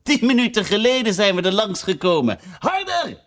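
high, scornful queen voice; mock her, then drive her on; Red Queen mocking Alice mid-run; haughty queen. Queen scolding Alice while running.

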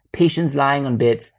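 ordinary delivery

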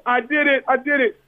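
high, giddy "I did it" cheer; a hammed-up high victory squeal; mock celebration. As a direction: squeal it like a kid who just won. Imagined celebration, high pitched.

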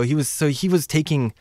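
normal talking voice

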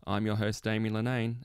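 ordinary talk, nothing marked

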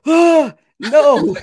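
loud high shriek of comic dread; loud high hammed-up scream; mock scream. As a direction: yelp like you've been caught. She finds him again.

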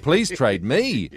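slow, with me stressed. Shown as a demonstration. Host mocking the relative who stresses me, slowly. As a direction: drag it out and stress me. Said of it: "the emphasis on the me".

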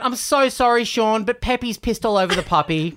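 high, gushing apology; apologetic lady. Retold or imitated. Woman apologising to Sean in a high flustered voice. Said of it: "And she went".

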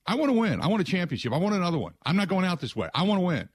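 high, fired-up, determined declaration; emphatic. Retold or imitated. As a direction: insist like a champion who won't quit. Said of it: "He's emphatic".

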